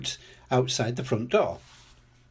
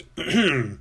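throat clearing. A narrator's reading. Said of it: "Peppa cleared her throat".

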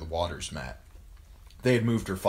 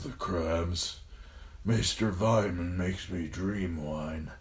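dying father in pain. A narrator's reading. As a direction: labour through each phrase, racked by pain. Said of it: "A spasm of pain took him".

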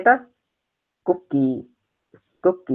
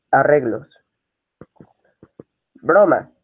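normal talking voice

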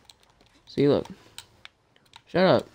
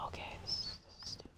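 whispered annoyance; 22 dB quieter, faster than usual. Real, not acted. Annoyed at shop menu.

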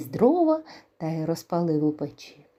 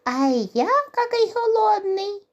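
hungry mouse; 4 dB louder than usual. Acted. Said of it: "каже верть".

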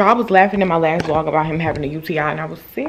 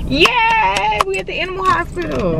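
high sarcastic cheer; fake-bright announcement of arrival; sarcastic high-pitched yay; mock cheer; 12 semitones higher than usual. Real, not acted.

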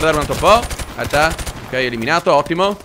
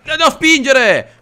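high, drawn-out protest; exasperated yell; 8 semitones higher, 4 dB louder, slower than usual. Real, not acted. Player to teammates pushing him, exasperated yell. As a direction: protest loudly, stretching it out.

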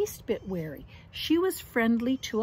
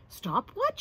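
innocent little sister; 10 semitones higher than usual. Acted.

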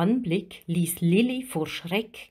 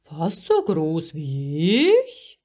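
slow, big creature echo; drawn-out question rising at the end; giant octopus; 3 semitones lower, 4 dB louder, slower than usual. A narrator's reading. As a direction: sceptical sea giant, stretched vowels. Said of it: "Der Krake kratzte sich nachdenklich".

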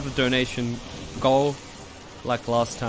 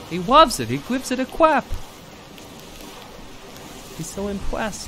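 cooing; 10 semitones higher than usual. Real, not acted. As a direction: gush over the pet. Cooing at pet monkey.

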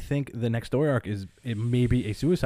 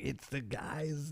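high, soft, drawn-out gasp of disbelief; very quiet and slow, reliving the shock; mock-stunned, small high voice; stunned hush; 5 semitones higher, 12 dB quieter, much slower than usual. Retelling his stunned answer to girlfriend.

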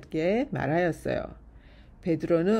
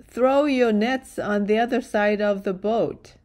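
raised, slightly higher call; 4 semitones higher, 5 dB louder, much slower than usual. A narrator's reading. A man on shore to fishermen in a boat, calling out. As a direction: call out louder across the water. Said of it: "The man called out".

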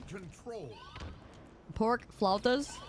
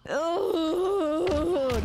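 long, higher moaning groan; 5 semitones higher than usual. Real, not acted. Streamer reminded of a meme, long moaning groan.

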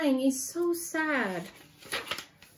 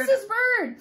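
excited; 9 semitones higher than usual. A narrator's reading. Surprise reveal to child.

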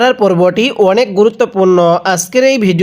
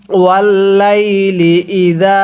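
ওয়াল্লাইলি drawn out slowly; slow recitation; slower than usual. Shown as a demonstration. Teacher models the word for students, slowly. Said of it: "তাহলে কিভাবে পড়ব?".

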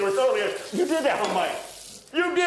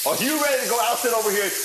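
normal talking voice

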